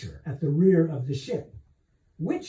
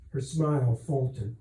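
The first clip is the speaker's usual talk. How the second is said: ordinary delivery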